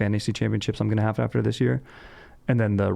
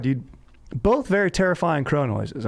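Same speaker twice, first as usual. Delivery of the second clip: ordinary delivery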